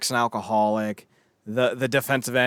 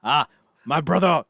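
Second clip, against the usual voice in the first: attempted Russian accent, higher and louder; bad Russian accent; 4 semitones higher, 6 dB louder than usual. Russian prisoner greets his brother, botched Russian accent. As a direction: hail a comrade in a shaky Russian accent. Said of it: "Wait, I can't do a Russian accent".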